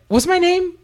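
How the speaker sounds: high incredulous question; indignant disbelief. Retold or imitated. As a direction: demand it in shocked outrage. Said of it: "I was like".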